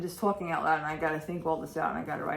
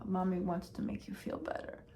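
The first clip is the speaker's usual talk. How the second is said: ordinary talk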